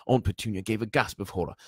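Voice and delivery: plain reading voice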